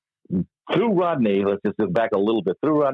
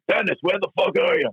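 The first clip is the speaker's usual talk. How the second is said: imitated yell; 12 semitones higher than usual. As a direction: imitate George calling out from upstairs. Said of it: "I hear him just yelling".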